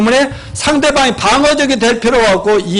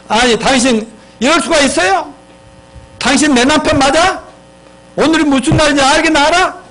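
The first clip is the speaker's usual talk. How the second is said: higher-pitched mock reproach; accusing wife; 5 semitones higher than usual. Wife confronts husband who came home late on her birthday, accusing. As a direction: act out a wife on the attack. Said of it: "하면서 공격하는 것이에요".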